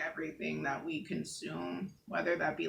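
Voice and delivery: ordinary talk, nothing marked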